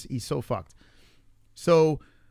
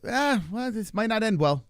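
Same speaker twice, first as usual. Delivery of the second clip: higher, offhand shrug of a voice; mock calm staff; 7 semitones higher than usual. Staff breaking bad news to parents, mock casual calm. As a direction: imitate staff taking it far too lightly. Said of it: "everyone's super calm there".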